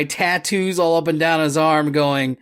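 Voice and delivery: ordinary delivery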